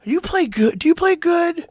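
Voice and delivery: high put-on voice, probing; suspicious opponent voice. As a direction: hustler asks if you play good, high mock voice.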